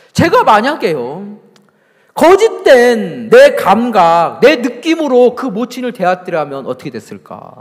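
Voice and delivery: loud, high, drawn-out pulpit delivery; forceful preaching. Real, not acted. Preacher putting a challenge to the congregation, forceful preaching. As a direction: drive each phrase home at full voice.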